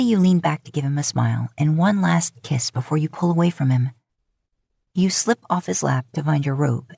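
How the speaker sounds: usual speaking voice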